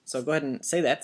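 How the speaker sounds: normal talking voice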